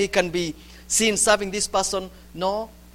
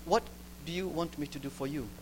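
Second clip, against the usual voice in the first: much softer, lower, nearly hushed; soft tender question; 6 semitones lower, 14 dB quieter than usual. Acted. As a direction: ask it tenderly, almost hushed. God's gentle question to the troubled.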